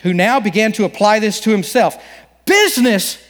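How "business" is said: ghost crying out the word, higher; a touch of an anguished ghost cry; unearthly voice. Acted. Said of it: "cried the ghost".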